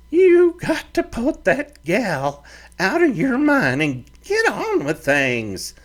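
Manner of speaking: high, gentler ranch mother voice; fiery mother turned kindly; softened mother. Acted. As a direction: ease off the scolding, offer supper. Mother advises son.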